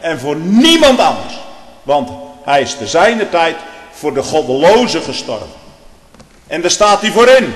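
loud, high pulpit voice; fiery preaching. Acted. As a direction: thunder it from the pulpit. Preaching at full force. (vurig preken)

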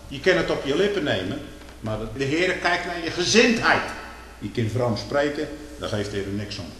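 ordinary talk, nothing marked

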